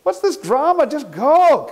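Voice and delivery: high impatient snap; teacher dismissing her in a high annoyed voice. Acted. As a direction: brush off her complaint. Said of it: "He said".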